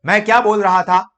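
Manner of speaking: a sudden loud shout, far too loud. Shown as a demonstration. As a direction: blast it out at full volume to show the mistake. Teacher mock-shouting too loud.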